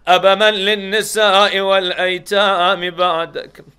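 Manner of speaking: loud wailing chant in Arabic; crying lament. Acted. Girl wailing over her father's head, loud and keening. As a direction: wail it out for the father. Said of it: "and cried".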